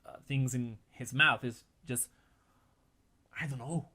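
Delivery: normal talking voice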